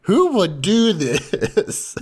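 high, drawn-out mock disbelief; mock dismay. Everyone reacting to her mud ball, mock dismay. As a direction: stretch it out high, acting appalled. Others aghast at the gift.

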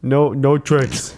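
lightly voiced dazed boxer, dragging it out; slow punch-drunk fighter's protest; Rocky impression. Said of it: "He was like".

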